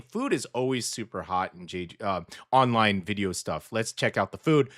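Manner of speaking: ordinary talk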